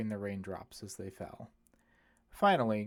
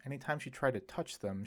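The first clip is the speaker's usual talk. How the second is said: ordinary delivery, nothing marked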